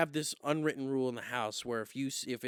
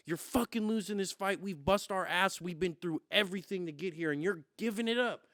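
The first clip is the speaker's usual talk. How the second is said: shouted, higher coach pep talk; fired-up coach; 6 semitones higher, 6 dB louder than usual. Retold or imitated. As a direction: bark it like a corner coach rallying his fighter. Coach's fiery between-rounds speech.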